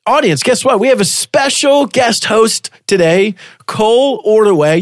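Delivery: high-pitched host intro; excited guest announcement. Real, not acted.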